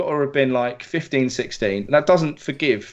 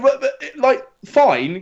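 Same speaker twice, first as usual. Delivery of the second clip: ordinary talk, nothing marked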